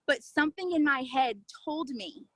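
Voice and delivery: usual speaking voice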